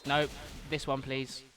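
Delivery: ordinary delivery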